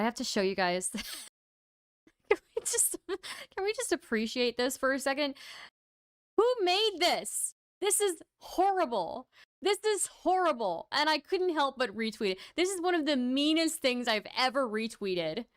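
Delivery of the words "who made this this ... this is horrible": appalled disbelief. Real, not acted. Reacting to a mean retweet.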